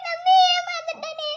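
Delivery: slow crying wail; slow sobbing cry; wailing lament. Acted. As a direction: wail at being fooled. Betrayed woman laments.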